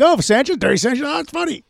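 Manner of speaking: high-pitched mock amusement; breezy joking. Host showing how he'd joke, breezy. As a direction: toss it off lightly, hammed up. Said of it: "I would have said it like".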